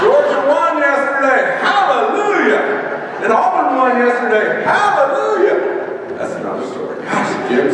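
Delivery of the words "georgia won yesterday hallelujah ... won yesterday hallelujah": cheering shout. Real, not acted. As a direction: celebrate each win like a fan yelling from the stands. Cheering his football teams' wins.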